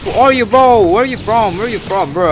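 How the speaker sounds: high, loud questioning; bewildered demand. Real, not acted. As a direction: call out to a stranger in disbelief. Confronts an unknown player in game.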